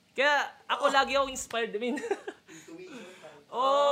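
ordinary talk

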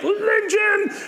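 very high, hammed-up British shriek; mock British outcry. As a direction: squawk it as an outraged British caricature. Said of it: "British people with accents are gonna be like".

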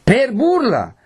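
very high outraged repeat; indignant cry. A narrator's reading. Renzo glares at the mother, crying out in outrage. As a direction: hurl the question back, incensed. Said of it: "gridò Renzo".

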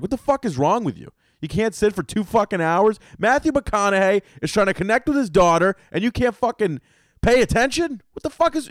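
high-pitched, exasperated scolding; stern talking-to. Retold or imitated. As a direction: tear into a friend, rising as you go. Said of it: "I gave him a stern fucking talking to".

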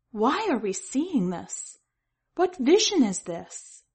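rising alarmed plea; pleading. Acted. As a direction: beg for an answer, frightened.

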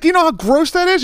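grossed out, pitching it way up; disgusted. Retold or imitated. Sponsor scolds the housesitter, high disgusted squeal. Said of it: "He's like".

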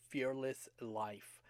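usual speaking voice

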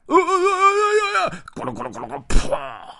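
high yelps tumbling into a thud; sliding-fall yelps. Acted. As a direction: yelp all the way down the roof. Bear slides off the roof. (从房顶滑下的惊叫)

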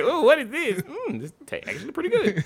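high, delighted voice tasting food; surprised taster. Retold or imitated. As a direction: act pleasantly surprised by the taste. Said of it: "You're like".

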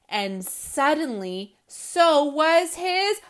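put-on animated children's book reading, loud punchlines; lively read-aloud. A narrator's reading. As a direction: read it with big comic energy for kids. Reads picture book aloud.

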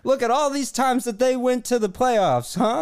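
high, mocking rant at a sports rival; hammed-up indignant comeback; a pretend argument with a fan who disagrees, mocking and high; mock angry rant.

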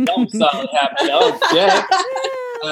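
high, giggling through the words; real laughter breaking through; laughing. Real, not acted. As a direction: crack up while saying it.

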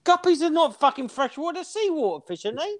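very high, squeaky disbelief; incredulous challenge. Real, not acted. Friend challenging the guppy owner, high incredulous squeak. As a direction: pitch it right up in baffled protest.

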